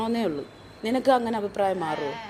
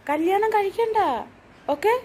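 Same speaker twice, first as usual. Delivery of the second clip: much higher, sing-song comfort; high coaxing voice; 10 semitones higher, 3 dB louder than usual. Real, not acted. Parent comforting crying daughter in a high coaxing voice.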